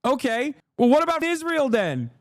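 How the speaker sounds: mock retort. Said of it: "And it's like".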